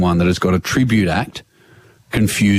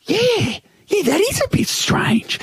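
very high-pitched retold agreement; dubious agreement; slower than usual. Sister agrees with mum that it's strange, retold dubious agreement.

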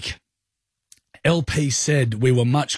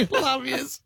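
laughing; 11 semitones higher, 5 dB quieter than usual. Real, not acted. Laughing sign-off.

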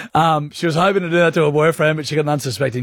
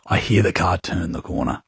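ordinary talk

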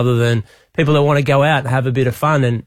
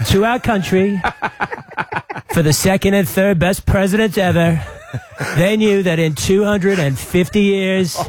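higher, slow presidential oratory parody; Donald Trump impression; 6 semitones higher, slower than usual. Premier entertaining radio hosts with a mock Trump speech. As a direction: declaim it grandly with long pauses. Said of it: "caught wind of your Trump impersonation".

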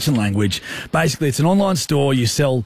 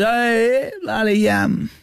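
higher, laid-back Aussie mate; Smithy impression; 9 semitones higher, much slower than usual. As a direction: read his voice note as him, pitched up. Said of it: "Smith Tech impression".

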